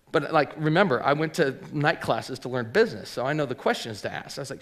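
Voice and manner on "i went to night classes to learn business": ordinary delivery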